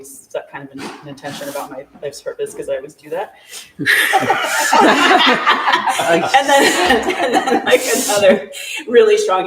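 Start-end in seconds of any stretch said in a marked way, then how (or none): 3.8-9.5 laughing through the words, pitched up; breaking into real laughter mid-sentence. Real, not acted. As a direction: giggle while getting the words out. Laughing at her own habit.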